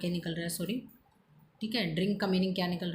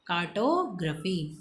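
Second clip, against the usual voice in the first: slow drilled word; 2 semitones lower, much slower than usual. Shown as a demonstration. Teacher drills new word.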